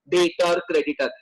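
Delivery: ordinary delivery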